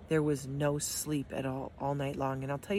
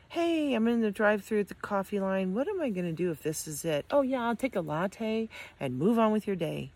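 higher, breezy drive-thru small talk; lifted, offhand mock chitchat; mock casual chatter; 5 semitones higher, 3 dB louder than usual. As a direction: toss it off like a trivial errand. Mock casual coffee-line chatter as contrast.

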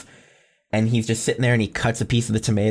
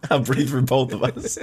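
mock hero line; 3 semitones higher than usual. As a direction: ham up the noble hero. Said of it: "Yeah, you're just".

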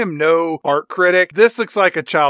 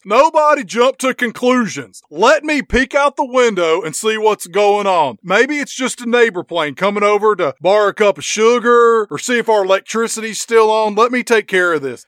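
lightly voiced agent, a bit higher and louder; mock calm agent; 4 semitones higher, 3 dB louder than usual. Agent reassuring the plane crew, lightly voiced mock calm. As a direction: play a cocky federal agent soothing the crew. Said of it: "And Special Agent Doctor Phil says".

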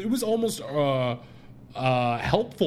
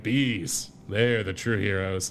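deep solemn voice, lower; low Sean Bean impression; 7 semitones lower, slower than usual. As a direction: gravely announce it, low and slow. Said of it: "Sean Bean is there to just be like".

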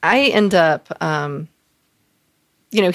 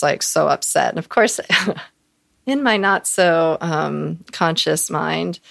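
usual speaking voice

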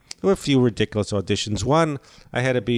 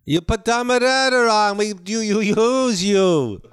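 high-pitched Israeli accent, imitating Jamie; 10 semitones higher, 3 dB louder than usual. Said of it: "Jamie, from the Laugh Factory".

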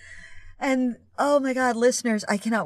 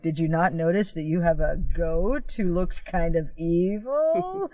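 slow, lower, drawn-out incredulity; mock disbelief; 4 semitones lower than usual. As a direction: stretch it out, pointedly dumbfounded. Mock-incredulous line to film characters.

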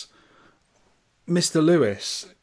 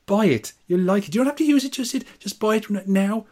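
higher, wheedling voice of a wallet coaxing him to buy; tempting wallet voice; 5 semitones higher than usual. Pretend. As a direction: play the little devil on his shoulder, coaxing. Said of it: "my wallet keeps going".

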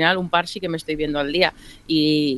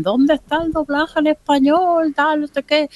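outraged fan; 11 semitones higher than usual. Retold or imitated. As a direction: whine like an angry viewer. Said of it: "ya sabéis cómo se pone en plan".

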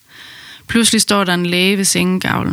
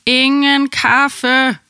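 drawn-out, higher-pitched call; retold shout; 6 semitones higher, much slower than usual. Labouring mother calls to boyfriend about his coffee, retold shout. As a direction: call it out long and high, as if across a room. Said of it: "Jeg havde råbt til ham".

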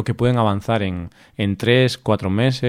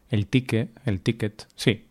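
normal talking voice

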